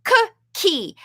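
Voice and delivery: Cookie, put the k in the middle clearly; emphasized model word. Shown as a demonstration. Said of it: "say it slowly and a little bit more loudly".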